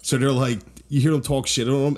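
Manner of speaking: ordinary delivery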